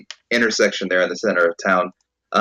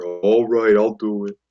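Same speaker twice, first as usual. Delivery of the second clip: a hint of a slow big lad; lightly voiced young giant; Periwinkle. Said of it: "you just hear".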